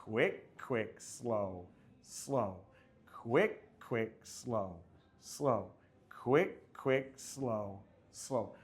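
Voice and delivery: quick quick rising, slow slow falling and drawn out; counting the rhythm. Shown as a demonstration. Instructor counting steps to class in rhythm. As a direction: call the steps like a dance count. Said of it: "It'll go like this".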